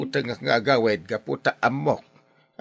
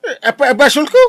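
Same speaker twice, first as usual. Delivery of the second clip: higher, louder outraged question; indignant disbelief; 8 semitones higher, 11 dB louder than usual. Acted. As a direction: throw the blame back in shock. Indignant at being blamed. (nasdaaw ken nakaunget)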